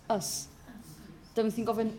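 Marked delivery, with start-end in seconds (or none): 0.1-0.5 "us" said short and unstressed, like the word's ending; model the ending sound. Shown as a demonstration. Teacher shows students the ending sound, short demo. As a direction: show the sound "us" on its own. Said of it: "think of us".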